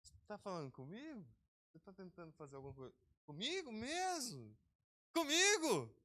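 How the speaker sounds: incredulous challenge building to a shout; mock disbelief. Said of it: "você olha pra ele e fala assim".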